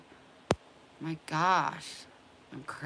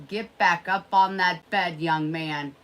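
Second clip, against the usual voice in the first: louder, firm order to the dog; stern command; 10 dB louder than usual. Real, not acted. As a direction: scold the dog back onto the bed.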